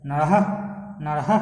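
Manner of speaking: নরহ ending on a pulled h sound; slow demo of নরহ with the h drawn out; drawn-out visarga. Shown as a demonstration. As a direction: lean on the final হ of নরহ. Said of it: "এই যে হকে টানছি".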